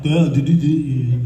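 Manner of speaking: tongue-tied mock stammer; stuttered, senseless babble in imitation; bobbling words. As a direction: imitate someone too starstruck to get words out. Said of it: "he started bobbling his words".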